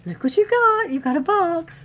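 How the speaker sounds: high, loud teasing sing-out; excited housemate. Retold or imitated. Vlogger imitates housemate shaking the box, excited. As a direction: imitate an excited giant at the door. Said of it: "He's all like".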